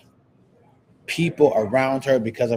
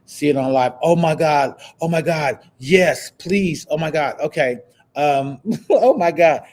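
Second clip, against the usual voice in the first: excited; 4 semitones higher, 5 dB louder than usual. Real, not acted. Thrilled reaction to viewer's news.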